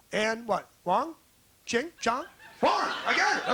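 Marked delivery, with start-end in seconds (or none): 2.6-3.5 triumphant shout. Acted. Guessing an audience member's name.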